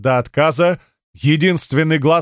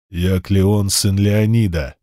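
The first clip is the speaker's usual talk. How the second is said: gruff wrestler; 6 semitones lower, slower than usual. Acted. Wrestler Kleon speaks.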